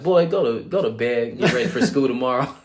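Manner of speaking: hammed-up impatient mother; a mother shooing her son off to bed, mock scold; mom brushing off. Mom dismissing him.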